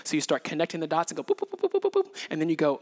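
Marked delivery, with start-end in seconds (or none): 1.2-2.3 high playful boops for each dot; boop sound effect. Pretend. Pastor marks connected dots with playful high boops.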